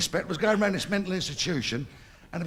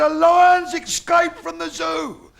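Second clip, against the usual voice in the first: slow, loud mock shout; 10 semitones higher, 9 dB louder, much slower than usual. Geezer shouts news of the escaped lion. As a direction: bellow the warning slowly.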